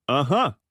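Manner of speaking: Uh-huh with the last syllable leaned on; stress on last syllable. Acted. Ex-husband to ex-wife at the bar, stress on the huh in Uh-huh. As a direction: hit the huh in Uh-huh. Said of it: "emphasizing the last syllable".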